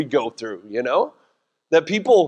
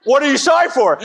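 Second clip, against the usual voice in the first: mock wife question; 8 semitones higher, 4 dB louder, faster than usual. Wife's reply voiced.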